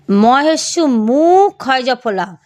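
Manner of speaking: ordinary talk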